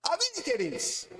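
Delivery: usual speaking voice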